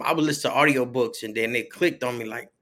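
ordinary talk

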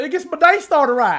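high, hammed-up Southern twang; mock southern accent. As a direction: tease her with an exaggerated Southern accent. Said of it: "she went all southern on that".

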